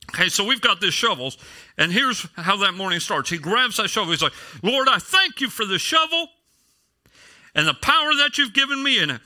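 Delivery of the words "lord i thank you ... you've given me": high-pitched, hammed-up prayer of thanks; imitated fervent prayer. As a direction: pray like an overzealous believer. Retold fervent prayer over a shovel.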